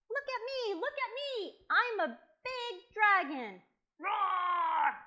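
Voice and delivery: put-on high, loud child's bragging into a roar; boastful pretend dragon. Boy showing the dragons he is big, high boastful shout.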